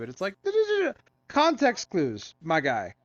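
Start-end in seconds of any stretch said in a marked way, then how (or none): 0.4-1.0 very high, sing-song nonsense syllables; mocking singsong. Mocking the oblivious cop with a high singsong. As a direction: taunt like she's not listening.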